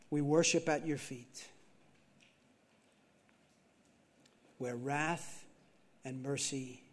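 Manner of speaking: slow, hushed recital with long pauses; spoken hymn lines, measured and increasingly quiet; reverent hymn recitation. Acted. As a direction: let each phrase sink in, softly.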